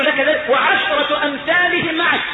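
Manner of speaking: high, drawn-out proclamation; exultant granting. Acted. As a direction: proclaim the gift slowly, in wonder. إعادة العطاء بتعظيم.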